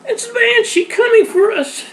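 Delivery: very high, loud frightened shriek; little girl woken by pounding, screaming in fear; terrified scream. Acted. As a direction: wake screaming in terror. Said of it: "Alice screamed".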